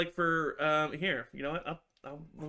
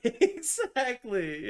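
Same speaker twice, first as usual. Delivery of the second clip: high, slow exactly through a laugh; laughing agreement; 10 semitones higher, 7 dB louder, much slower than usual. Real, not acted. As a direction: stretch it out while laughing. Agreeing with friend, laughing hard.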